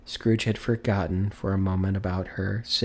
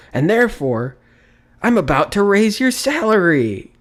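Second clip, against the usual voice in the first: loud, much higher triumphant burst; spring the good news; joyful announcement; 11 semitones higher, 8 dB louder than usual. Acted. Scrooge announces Bob's raise in a loud, high burst.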